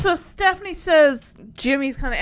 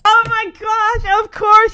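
excited; 9 semitones higher, 11 dB louder than usual. Real, not acted. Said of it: "own excitement".

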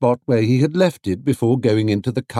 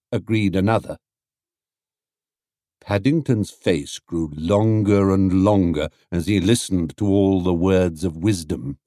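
ordinary delivery

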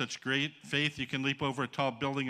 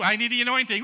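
loud, higher mock revival shout; shouting mock plea for anointing; mock hyped preacher; 9 semitones higher, 8 dB louder than usual. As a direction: parody a hyped charismatic. Mocking hyped-up faith talk.

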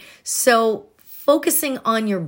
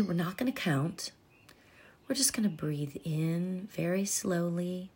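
soft spa whisper; 2 semitones lower, 8 dB quieter than usual. Acted. Leads a body breathing meditation.

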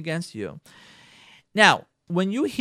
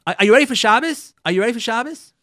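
higher, louder husband's question; 7 semitones higher, 8 dB louder, faster than usual. Retold or imitated. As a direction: ask it like a husband on the phone. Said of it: "Erev Shabbos says".

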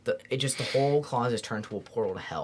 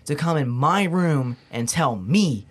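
angry confrontation; 3 semitones higher, 5 dB louder than usual. Retold or imitated. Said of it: "I got pissed off".

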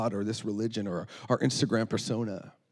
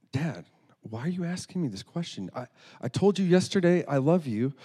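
higher, acted exasperated protest; 7 semitones higher, 4 dB louder than usual. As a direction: play a daughter fed up with being asked. Girl fed up with repeated question.

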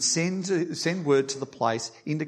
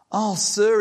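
anguished cry, louder and higher; cried out; 7 semitones higher, 6 dB louder than usual. A narrator's reading. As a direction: cry out in dismay over the lost axe. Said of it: "he cried".

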